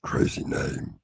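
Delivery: hoarse near-whispered deep growl; croaky attempt at a deep trailer voice; low movie-trailer voice. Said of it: "I'm gonna give it a go".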